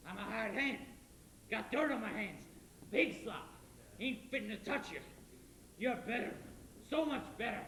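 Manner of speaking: bitter, rising to a shout; furious hired hand shouting at the lady; angry outburst. Acted. Judd's bitter outburst at Laurie.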